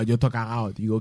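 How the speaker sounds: ordinary talk, nothing marked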